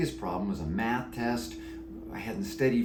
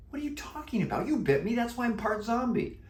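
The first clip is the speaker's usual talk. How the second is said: surprised. Acted. Boy protests he was bitten.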